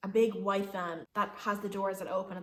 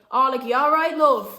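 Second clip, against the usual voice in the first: loud, higher imitated holler; called-out concern; 5 semitones higher, 14 dB louder than usual. Bus driver asking woman if she's okay, loud call.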